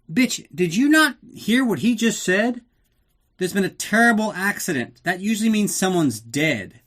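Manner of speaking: hammed-up snapping at a dim girlfriend; mock exasperated retort. Narrator mocking a reply to Tanya, high exasperated put-on voice.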